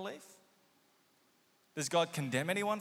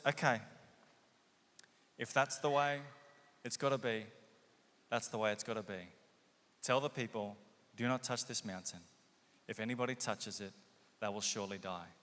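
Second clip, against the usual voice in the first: resigned God; 8 semitones lower, 6 dB quieter than usual. Acted. God resigned to their terms.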